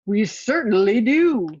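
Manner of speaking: sly alligator host, drawn out. A narrator's reading. Alligator greets the duck customer slowly.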